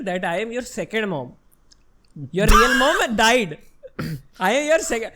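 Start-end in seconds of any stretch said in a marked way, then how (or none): 2.3-5.1 louder, slowed, laughing through mum's joke; laughing mock quote. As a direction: retell mum's cruel joke while cracking up. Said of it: "She told me once, in a joking manner".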